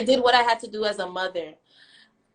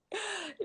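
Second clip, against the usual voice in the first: laughing; 7 semitones higher, 15 dB quieter than usual. Real, not acted. Laugh mid-rant.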